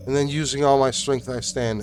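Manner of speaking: ordinary delivery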